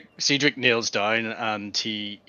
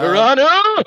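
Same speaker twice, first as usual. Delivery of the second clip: high, loud summoning yell; calling out; 11 semitones higher, 8 dB louder, slower than usual. Acted. Player in character summons Arano, loud call. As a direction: holler the name, stretched at the end.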